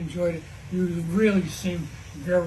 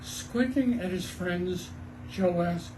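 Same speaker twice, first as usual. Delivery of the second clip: usual speaking voice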